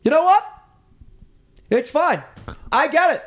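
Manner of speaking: high, loud ranting impression; stammering mock outburst at full volume; Larry David. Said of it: "and Larry just goes".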